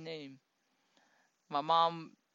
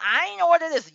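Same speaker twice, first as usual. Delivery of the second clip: indignant customer; 8 semitones higher, 7 dB louder than usual. Retold or imitated. Customer protesting an unexpected order.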